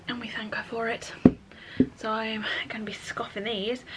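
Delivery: conspiratorial whisper; hushed snack confession. Real, not acted. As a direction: whisper it like a guilty secret. Confiding about doughnuts to camera.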